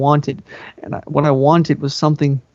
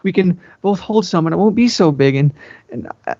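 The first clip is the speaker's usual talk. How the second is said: usual speaking voice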